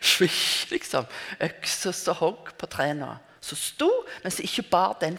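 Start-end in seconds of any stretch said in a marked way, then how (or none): 0.0-0.7 breathy swish of an axe swing. Retold or imitated. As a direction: hiss it like an axe cutting air. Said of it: "hun demonstrerte det sånn at vi syntes vi hørte svisj". (hvislende svisj som et økseslag)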